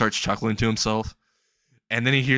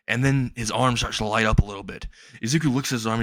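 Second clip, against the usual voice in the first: plain reading voice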